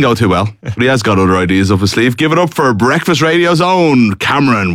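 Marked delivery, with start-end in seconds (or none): 2.2-4.7 raised-pitch contestant introduction, slowing at the end; show announcer. Acted. Host brings on the breakfast radio contestant, hyped announcer voice. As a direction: hype up the next player, drawing out the finish.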